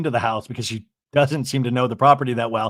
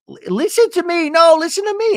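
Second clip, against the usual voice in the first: very high, mock-frantic insisting; retold insistent plea; 14 semitones higher, 3 dB louder than usual. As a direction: imitate someone demanding to be heard. Acted pickleball quarrel.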